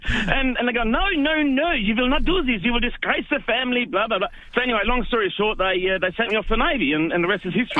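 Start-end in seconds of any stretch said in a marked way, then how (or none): 0.8-4.3 higher, hammed-up strict parent laying down the law; mock stern parents. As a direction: imitate a parent forbidding it, fading to blah blah. Parents forbid the circus.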